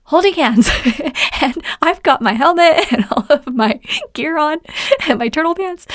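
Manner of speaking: talking through real chuckles; amused laughter. Real, not acted. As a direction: giggle while listing the gear. Amused by her own story.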